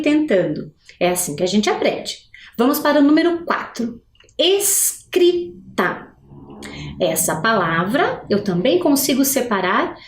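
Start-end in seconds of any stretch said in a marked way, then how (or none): 4.4-6.1 "Escrita" very slowly as es-cri-ta; syllable by syllable. Shown as a demonstration. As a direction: stretch and part each syllable of "Escrita", high. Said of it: "Quantas vezes eu vou abrir a minha boca". ("Escrita" sílaba por sílaba, bem devagar)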